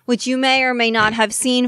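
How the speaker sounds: usual speaking voice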